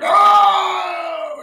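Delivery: very high, loud yelp of pain. Retold or imitated. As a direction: yelp as if cut. Baby reacts to cut.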